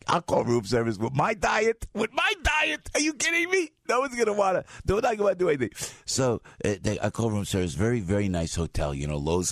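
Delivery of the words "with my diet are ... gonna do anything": much higher, scoffing disbelief; mock incredulous. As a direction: scoff at the idea, pitched way up. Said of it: "I said".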